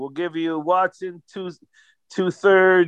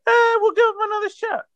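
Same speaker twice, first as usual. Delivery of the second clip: mock high voice. Mock GM on the phone.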